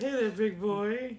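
lightly high mock flirt; a hint of hammy sultry come-on; failed seductive. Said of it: "She said".

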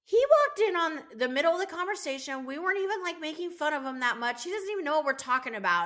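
high-pitched, worked-up complaint; exasperated mom imitation. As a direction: protest like a fed-up mother, hammed up. Retells exasperated mom on phone.

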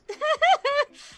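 high nervous giggle; a quick anxious giggle; nervous laugh. Real, not acted. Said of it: "there's the nervous laugh again".

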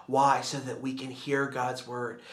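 usual speaking voice

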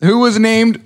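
high, laughing stumble over the sentence; laughing read. Real, not acted. Co-host stumbling over a badly written news story, high and giggling. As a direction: read on while cracking up. Misreading paragraph.

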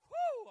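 loud high-pitched "woo!"; excited whoop. Real, not acted. Preacher hypes himself up mid sermon.

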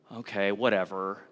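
put-on low, soft teenage brush-off; indifferent teenager. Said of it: "You know, teenagers".

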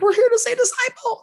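very high, loud mock squeal; excited squeal. Wife seeing the band merchandise at the venue, excited squeal. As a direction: imitate her excited high-pitched outburst. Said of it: "she gets all excited".

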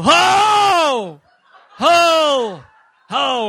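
high, loud laughing ho; bursting out like a man overcome; mock holy shout. Imitating the tent preacher, loud ho shouts. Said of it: "he'd go".

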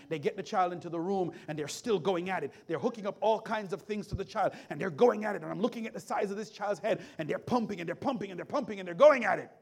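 mounting urgency. Retold or imitated. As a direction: drive the rescue scene harder and harder.

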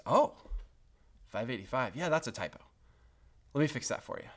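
soft, gentle reassurance; kindly clerk, softly and casually obliging; sweet, kind. Retold or imitated. Lady at propane company answering a complaint, sweet and kind. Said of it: "was so sweet, so kind".

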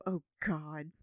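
weary groan. Acted. Casey dreading mom's call.

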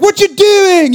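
high, loud hammed-up British accent; mock British yell. Gordon Ramsay on a cooking show, mock British yell. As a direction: yell like a British TV chef, badly faked. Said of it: "that's my British accent".

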